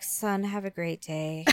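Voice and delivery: soft, embarrassed murmur; sheepish murmur. Retold or imitated. Mother dropping her late son off in front of class. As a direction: mumble it at the classroom door.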